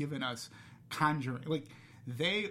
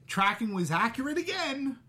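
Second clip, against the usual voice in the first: higher, louder mock amazement; heavy sarcasm, pitched up high; sarcastic; 10 semitones higher, 6 dB louder than usual. Real, not acted. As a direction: say it sarcastically, with loud false wonder. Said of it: "I say that sarcastically".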